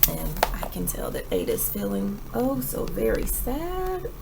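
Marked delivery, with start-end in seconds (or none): none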